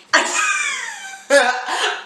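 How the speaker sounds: a sudden very high squeal of a laugh; high-pitched burst of laughter. Real, not acted. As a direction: shriek with laughter at how absurd it is. Remembering Jackson waiting outside.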